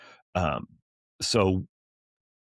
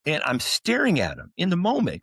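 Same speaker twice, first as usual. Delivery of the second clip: usual speaking voice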